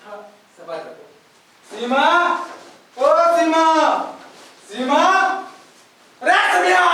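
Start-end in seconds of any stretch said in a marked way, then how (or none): 1.7-6.9 loud, rising calls to the wife; calling out. Acted. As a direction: yell her name through the house.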